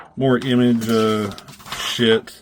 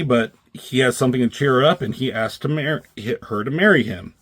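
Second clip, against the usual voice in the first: ordinary talk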